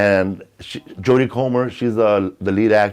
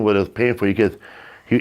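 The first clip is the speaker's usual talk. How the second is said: ordinary talk, nothing marked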